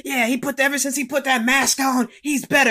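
high, screamed-out commentator imitation; Booker T. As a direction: holler it like a fired-up ringside announcer. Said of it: "screaming out".

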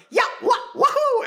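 high shouted Mario wahoo. Retold or imitated. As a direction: whoop like Mario. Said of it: "a spectrum of those voices".

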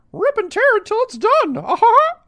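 very high, loud cartoon mouse squeal; horrible Mickey impression. Cohost reading a chat message, horrible squeaky Mickey impression. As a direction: badly imitate a squeaky cartoon mouse, ending in his laugh. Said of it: "that's my horrible Mickey impression".

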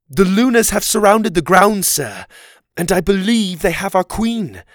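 high, loud, alarmed report; urgent report. A narrator's reading. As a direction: deliver the bad news with urgency. Oliver reports danger to the king.

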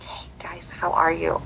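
very soft, near-whispered greeting; imitating a careful peacemaker; tentative hush. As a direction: ease in gingerly, barely audible. Host gently approaches arguing couple.

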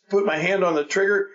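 normal talking voice

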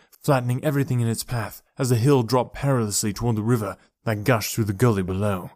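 ordinary delivery, nothing marked

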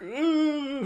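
high-pitched long "ooh"; mock falling cry. Imitating film villains falling to their deaths, mock alarmed. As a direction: imitate a falling man with a high, thin coo. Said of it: "being like".

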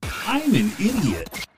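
very high, slow, stretched silly voice; put-on drop. Husband offers another drop on request, high drawn-out voice. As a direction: draw it out high like a cartoon catchphrase. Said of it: "Any other drops?".

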